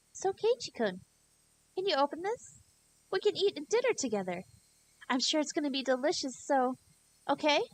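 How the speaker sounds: put-on high, sugary girl voice coaxing through the door; sweet creepy Rena.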